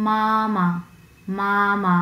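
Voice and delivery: মামা with both syllables long and flat; level first tone. Shown as a demonstration. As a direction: hold মামা on one steady pitch. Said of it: "আমরা উচ্চারণ করব".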